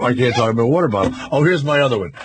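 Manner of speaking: ordinary talk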